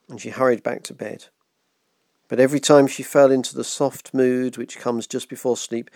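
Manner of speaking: usual speaking voice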